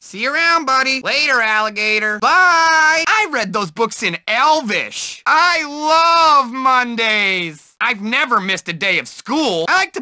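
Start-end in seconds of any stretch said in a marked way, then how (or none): 2.2-3.0 loud, high farewell; calling bye. Acted. Nerd calls goodbye to a buddy, loud. 5.3-7.7 very slow, dreamy delight; drawn out. Acted. Nerd says he loves Mondays, very slow and dreamy.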